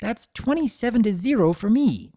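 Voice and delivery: put-on high, bright child bragging; filly teasing the statue; gleeful boast. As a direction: crow about the score.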